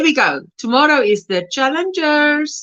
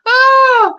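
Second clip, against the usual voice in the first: high laughing cry; 12 semitones higher, 7 dB louder than usual. Real, not acted. Still laughing at the chat.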